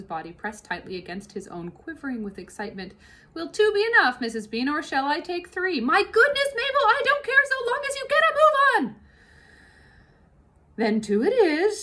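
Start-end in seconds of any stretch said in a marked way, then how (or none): 3.3-11.8 hearty cook, pitched up and louder; big kitchen woman grumbling about the fox; Mabel voice. Acted. Cook and mistress exchange.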